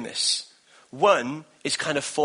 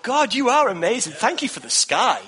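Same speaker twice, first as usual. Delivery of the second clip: higher, louder, awed thanks to God; thanking God for the sky; excited praise; 7 semitones higher, 3 dB louder than usual. Retold or imitated. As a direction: marvel out loud at the blue sky. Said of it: "And I thought".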